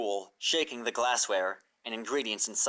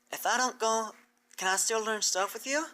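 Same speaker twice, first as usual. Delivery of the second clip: raised, hopeful little filly asking; a small hopeful plea; filly asking if her friend can come; Applebloom's voice; 9 semitones higher than usual. Acted. Applebloom asks timidly.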